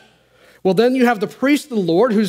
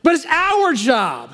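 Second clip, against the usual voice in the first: higher, swelling preacher's cadence; preaching; 10 semitones higher than usual. Acted. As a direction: build the sermon to a fervent peak. Preaching at full force.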